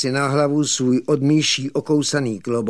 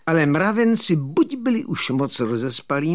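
ordinary delivery

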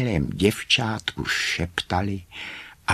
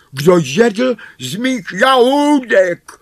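very high, loud dwarf voice; high, loud little-dwarf complaint; indignant fifth dwarf; 9 dB louder than usual. A narrator's reading. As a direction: wail the question as a wronged dwarf. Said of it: "ptal se pátý".

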